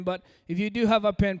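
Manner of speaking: usual speaking voice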